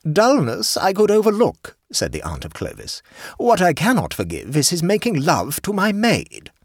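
aunt complaining to the hostess, indignant; stern aunt. A narrator's reading. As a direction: lay down the law like an affronted aunt. Stern aunt voiced by reader.

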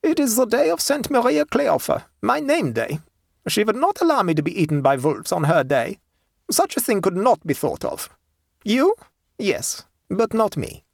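put-on high German-accented countrywoman, self-assured; composed peasant woman, pitched high. A narrator's reading. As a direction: explain with complacent certainty, German accent. Woman explains her name day.

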